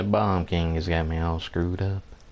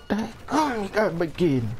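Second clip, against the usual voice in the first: exasperation; 6 semitones higher than usual. Real, not acted. Exasperated at the Grump again.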